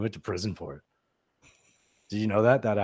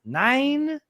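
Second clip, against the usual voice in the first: drawn-out, high movie-quote "nine times"; Ferris Bueller quote; 10 semitones higher than usual. Retold or imitated. Co-host breaking off a headline to quote the principal, drawn-out. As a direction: stretch each word slowly like the film principal. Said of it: "That's from Ferris Bueller".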